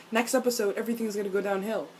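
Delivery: ordinary talk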